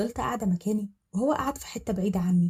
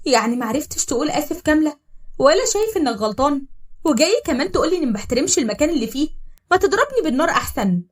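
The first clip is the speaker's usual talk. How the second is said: استنكار غاضب بصوت أعلى وأسرع; ردي عليه بسخرية وغيظ; خناقة غاضبة; 5 semitones higher, 6 dB louder than usual. Acted. تتخانق مع زين. (خناقة غاضبة مع زين)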